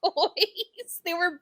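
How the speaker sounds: teasing, high and laughing; mock him through a laugh; laughing tease. Real, not acted.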